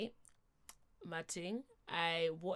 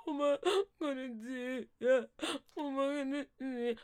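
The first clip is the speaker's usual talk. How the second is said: higher, crying voice breaking; tearful cry; 6 semitones higher, 4 dB louder than usual. Retold or imitated. Telling her friend she's pregnant, crying. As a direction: wail it through tears of shock. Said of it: "hey, the crying".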